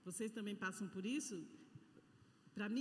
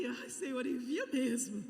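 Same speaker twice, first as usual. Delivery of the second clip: louder, laughing plea to the Lord; laughing prayer; 6 dB louder, slower than usual. Retold or imitated. Preacher joking to the congregation, laughing as she prays. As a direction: laugh through a weary mock prayer.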